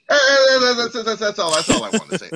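high stammering mock rant; sputtering outrage.

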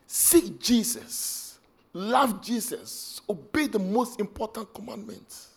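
slow exhortation. Acted.

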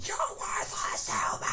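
whispered scream, high and strained; angry whisper scream. Shown as a demonstration. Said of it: "It's a great impression".

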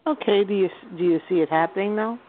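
ordinary delivery

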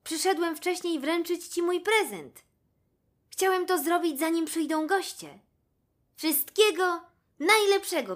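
jackal calling out. A narrator's reading. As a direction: call out your birthday wishes as the jackal. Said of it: "zawołał szakal". (szakal woła wysokim, głośnym głosem)

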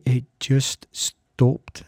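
it just stopped, said very slowly and drawn out; slow, hushed. Real, not acted. Telling listeners how the illness ended, slow and hushed. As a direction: let each word land slowly.

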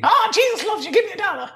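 very high, squeaky begging voice; a wheedling oddball on a train; crazy beggar. Acted. Crazy stranger begging Jeff for a dollar, squeaky high voice.

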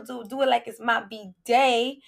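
usual speaking voice

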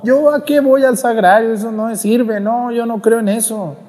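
put-on high, scornful young woman brushing it off; scornful daughter. Daughter waving off her mother's plea, scornfully high. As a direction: scoff like a daughter who thinks it's nonsense.

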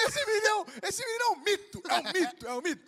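high, loud hype that fades down; hyped praise. Real, not acted. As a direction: praise him like a legend, then settle. Hyping friend as a legend. (exaltando o amigo, empolgado)

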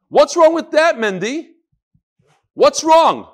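high, loud demand; loud challenge. Real, not acted. Reader challenges Mendy about the email, loud challenge. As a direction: call him out, pitch jumping up.

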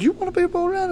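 high disbelieving question; surprised mock disbelief; incredulous. Dad incredulous at son.

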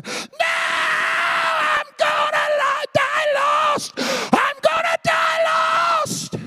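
shrieking in terror, high and slow; desperate dying shriek; blood-curdling scream. Retold or imitated. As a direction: scream it like a dying man terrified of hell. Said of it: "blood-curdling screams saying".

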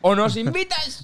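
mock threat. Pretend threat.

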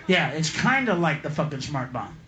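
usual speaking voice

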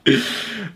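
trailing real laughter; high laugh. Real, not acted. Still laughing at the joke.

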